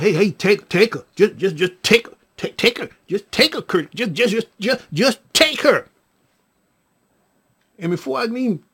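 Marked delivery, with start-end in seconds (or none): 0.0-5.9 stammered, repeated plea in bursts; mock panic, tripping over the repeated words; frantic pleading. Patricia hands over the baby mother, frantic. Acts out frantic handover at door.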